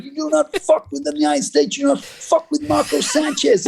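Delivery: high, hammed-up gangster-officer voice; tough customs officer. Mocking the customs officer who caught him, tough-guy voice. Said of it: "L A gangster dude in a customs uniform".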